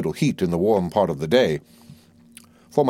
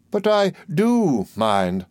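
a slow, higher, drawn-out insistence; 7 semitones higher, much slower than usual. Acted. Old soldier stopping the doctor's lips with her fan, slow and insistent. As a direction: lean on each word, slow and high.

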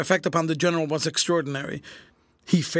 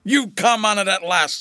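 raised, loud, amused voice; chuckling to himself; 6 semitones higher, 7 dB louder than usual. A narrator's reading. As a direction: gloat with amusement. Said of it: "chuckling to himself".

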